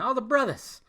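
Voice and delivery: "brothers" as "brothas", pitched high; "brothers" ending in A. Shown as a demonstration. Host repeats his call to the brothers, "brothers" pronounced "brothas". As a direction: say "brothers" with an A replacing the final R. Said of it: "I'm using the A at the end".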